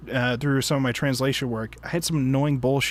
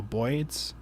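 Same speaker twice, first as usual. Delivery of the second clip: put-on old-school New York accent vowel; mock New York accent; 2 semitones lower, 7 dB quieter than usual. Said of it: "the New York is his birds".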